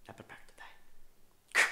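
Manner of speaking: hushed mock duel line, near silent; whispered. Comic whispering the famous revenge line. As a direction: breathe the threat out unvoiced. Princess Bride quote whispered.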